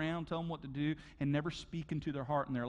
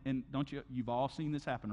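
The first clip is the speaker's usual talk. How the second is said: ordinary talk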